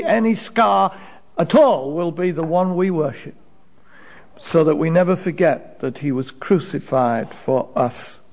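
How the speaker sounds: ordinary talk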